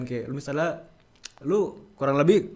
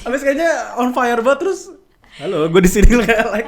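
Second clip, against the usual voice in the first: high loud laughing mockery; laughing tease; 9 semitones higher, 9 dB louder than usual. Real, not acted. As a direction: tease through giggles. Teasing imitation with laughter.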